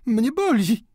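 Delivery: high-pitched exclamation of pain; pained cry. A narrator's reading. Daughter crushed in her father's embrace, crying out. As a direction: cry out as if squeezed too hard. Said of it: "Delfina zawołała".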